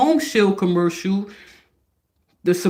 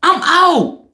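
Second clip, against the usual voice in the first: fed-up friend; 8 semitones higher, 4 dB louder than usual. Retold or imitated. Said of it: "Your friend is looking at you like".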